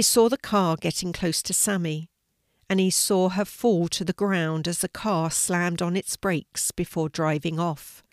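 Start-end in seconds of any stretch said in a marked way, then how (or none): none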